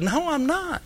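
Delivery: retold protest. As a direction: protest your innocence, acted out. Pastor denies in retold quarrel.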